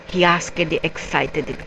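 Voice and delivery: ordinary delivery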